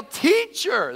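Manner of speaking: drawn-out high call; acted yell. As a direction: yell it to rouse a sleeper. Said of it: "They yell".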